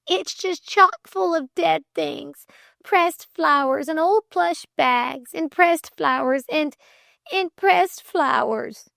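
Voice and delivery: high, upset complaint; quivering. A narrator's reading. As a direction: list it in a high, faltering lament. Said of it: "quivered Flame".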